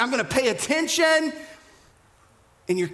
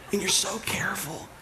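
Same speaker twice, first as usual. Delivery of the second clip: whisper; 6 dB quieter than usual. Shown as a demonstration. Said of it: "we talk lower".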